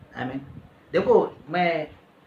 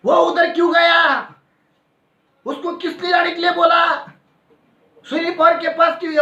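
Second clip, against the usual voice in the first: possessed woman yelling; 9 semitones higher than usual. Retold or imitated. Possessed woman screaming at the pastor coming near, high mock shout. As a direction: imitate her shrieking at the pastor, raised high. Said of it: "वहां पर वो चिल्ला रही है".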